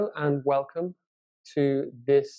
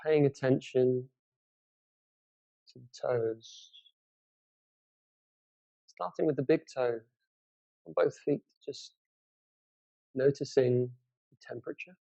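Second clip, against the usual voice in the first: guided meditation. Acted. Teacher leads listeners through a body scan from the toes, guided meditation. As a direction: guide them unhurriedly through a body scan, leaving space between phrases.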